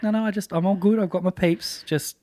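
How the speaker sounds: a hint of a brave face; brushing it off. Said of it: "And you're like".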